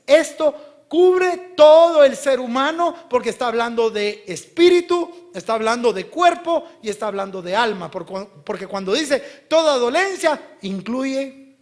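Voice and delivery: loud, high, emphatic sermon delivery; full-force preaching. Real, not acted. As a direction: drive it home to the congregation. (prédica a toda voz)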